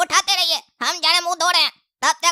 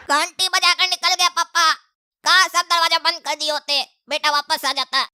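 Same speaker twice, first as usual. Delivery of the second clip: usual speaking voice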